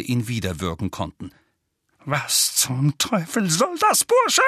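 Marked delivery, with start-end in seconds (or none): 2.0-4.4 slow strained fury, leaping up high at the end; hoarse, trembling rage. A narrator's reading. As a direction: seethe hoarsely at the boy. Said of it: "mit heiserer, vor Wut zitternder Stimme".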